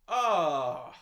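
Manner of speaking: loud, long exasperated groan. Real, not acted. Host fed up with the stay-at-home brother, exasperated groan. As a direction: let out a loud "ah" of frustration.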